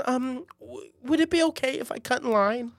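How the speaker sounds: frazzled assistant. Retold or imitated. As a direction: ask meekly, already worn down.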